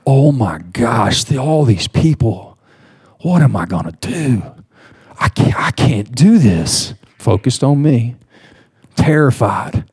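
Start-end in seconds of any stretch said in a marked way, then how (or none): 0.1-6.9 retold panic. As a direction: play back a panicky inner voice, understated. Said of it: "I start having a panic attack".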